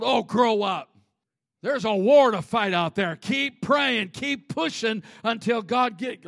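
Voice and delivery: loud, driving preacher's exhortation; booming motivational speech. Acted. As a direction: push the congregation hard, full voice. Preaches at full force.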